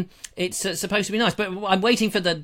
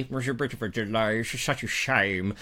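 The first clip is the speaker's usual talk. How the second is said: mock mournful voice; 9 semitones lower, 6 dB quieter than usual. Repeat in a put-on voice.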